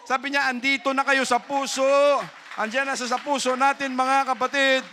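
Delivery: high, loud sermon delivery; rally the congregation with fire; preacher exhorting the brethren; full-force preaching. Acted.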